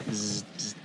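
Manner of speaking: soft buzzing zzz; mosquito whine. Pretend. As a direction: buzz quietly like a mosquito. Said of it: "impression of a mosquito?".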